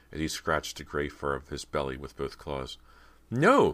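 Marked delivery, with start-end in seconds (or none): 3.3-3.7 trembling cry. A narrator's reading. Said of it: "in a trembling, weak voice".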